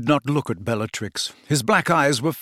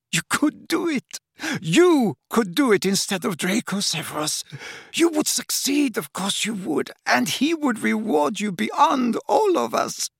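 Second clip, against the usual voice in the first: high, breathless begging; gasped, desperate plea; 9 semitones higher than usual. A narrator's reading. Narcissa begging Snape to save Draco, gasping. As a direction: clutch at him and beg. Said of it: "she gasped".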